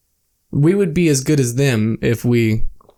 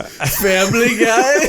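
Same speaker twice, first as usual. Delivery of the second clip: laughing; 9 semitones higher, much slower than usual. Retold or imitated. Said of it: "I was like".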